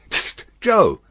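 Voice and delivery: high, urgent hail; cautioning call; warning voice. A narrator's reading. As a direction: alert a workmate sharply. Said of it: "in a warning voice".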